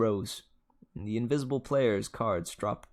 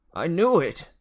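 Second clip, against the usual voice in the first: higher and louder, triumphant; excited exclamation; 6 semitones higher, 8 dB louder than usual. A narrator's reading. Grover blurting out his hunch, excited. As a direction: burst out in glee. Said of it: "Grover said".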